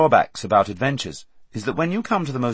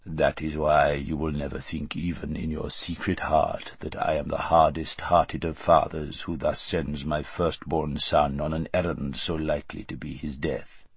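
cold Tisroc; 6 semitones lower, 5 dB quieter than usual. A narrator's reading. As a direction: speak as a smug, calculating emperor. Tisroc lectures the vizier.

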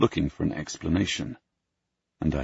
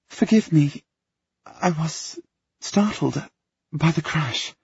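put-on shaken young woman; 11 semitones higher, slower than usual. Sarah shaken after the crash.

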